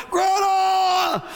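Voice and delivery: a full, high yell across a distance; a real bellow to his wife at the pool; yelling a name. Retold or imitated. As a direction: call her name from the eleventh floor. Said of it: "I yelled".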